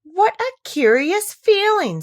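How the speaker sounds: high, slow amazed exclamation; wondering aloud. Acted. Alice marvelling as she starts to shrink, high and slow. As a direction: let the wonder stretch out slowly.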